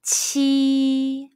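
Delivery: "qi" with a smile and strong puff; "qi" aspirated, high level tone; Mandarin qi. Shown as a demonstration. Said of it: "Say qi".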